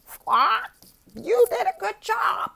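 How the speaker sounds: high squawking parrot, fading to a whispered squawk. Shown as a demonstration. Teacher doing the parrot cheer, high squawks. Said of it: "And then there's the parrot".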